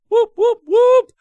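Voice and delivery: excited streamer cheer; cheering whoop. Real, not acted. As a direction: celebrate the resub out loud. Cheering a resubscriber.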